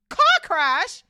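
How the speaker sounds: high, very loud outraged roar. A narrator's reading. Hagrid hearing the car crash lie, roaring. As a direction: bellow it in disbelief. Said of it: "roared, that ain't even Hagrid's accent".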